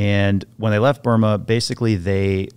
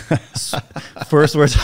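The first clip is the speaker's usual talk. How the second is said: usual speaking voice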